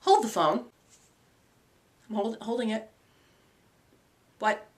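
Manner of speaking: dim alternate self. Acted. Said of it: "some of my alternate selves aren't that bright".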